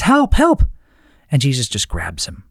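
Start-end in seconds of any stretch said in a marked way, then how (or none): 0.0-0.6 mock cry for help. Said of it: "Peter says".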